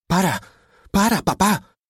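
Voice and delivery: high, urgent protest; alarmed daughter. A narrator's reading. Daughter tries to stop father.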